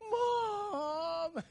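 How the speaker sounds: high, loud wail of "Mom!"; child crying for mom. Retold or imitated. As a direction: cry out "Mom!" like a hurt kid. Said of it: "It's the cry for mom".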